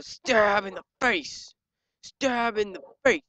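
hammed-up slow attack call; mock battle cry. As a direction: drag out each word as you attack.

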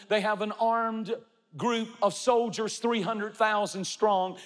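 ordinary talk

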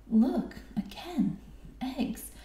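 high-pitched, excited exclaiming; amazed exclamation. A narrator's reading. Mother hyena finding food everywhere, exclaiming in amazement. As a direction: exclaim each find with delighted wonder, pitched well up. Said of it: "exclaimed Mama".

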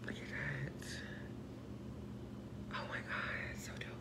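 soft unvoiced whisper of joy. Real, not acted. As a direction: breathe it out thrilled.

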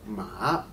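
"ma" drawn out, falling then rising; dipping third tone. Shown as a demonstration. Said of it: "goes lower and then higher".

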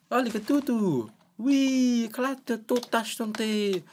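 very high, excitable gibberish voice; Sims language. Acted. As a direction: squeal out Sims babble, stretching the ends. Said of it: "they're just talking the Sims language".

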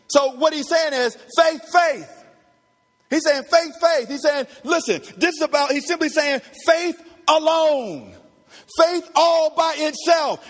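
high, emphatic pulpit delivery; forceful preaching. Real, not acted. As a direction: drive the point home at full force. Preaches faith alone at full force.

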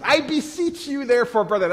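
imitating his old Southern accent; southern preacher accent. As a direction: ham up a young Southern preacher. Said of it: "I had this southern accent at the time".

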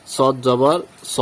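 drawn-out heavy "saw" for স; heavy letter. Shown as a demonstration. Teacher spelling out an example, slow thick rounded স. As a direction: slowly spell it out, ending on rounded স. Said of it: "সদ জবর স বলেছি".

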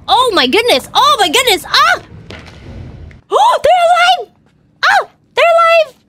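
panicked high screaming while fleeing; being chased. Real, not acted. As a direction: scream and yell run in real panic. Creatures come alive, player flees.

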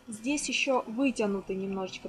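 usual speaking voice

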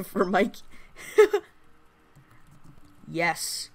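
normal talking voice

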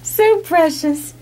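high, very slow cooing; adoring coo. Real, not acted. Owner melting over a puppy, slow high coo. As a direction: melt over the puppy, stretching each word.

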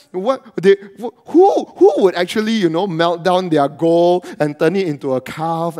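loud, fired-up sermon delivery; preaching at full force. Acted. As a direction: preach it out to the church.

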